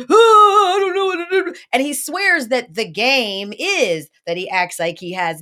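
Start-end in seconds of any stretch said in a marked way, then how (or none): 0.1-1.5 high, loud, put-on clueless voice; mock helpless guy. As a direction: squeak it out like a flustered shy guy. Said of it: "and he would just".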